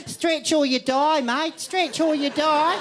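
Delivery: high, slow, drawn-out mock exercise coach; hammed-up fitness nut. Passengers at the plane's front, mock coaching voice. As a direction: preach stretching like a zealot. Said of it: "They're kind of like".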